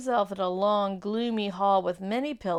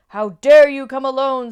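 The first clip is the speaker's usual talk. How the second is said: furious, much louder and higher; terrible witch voice; 6 semitones higher, 16 dB louder than usual. Acted. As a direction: blast it at him like an enraged queen. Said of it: "said the witch in a very terrible voice".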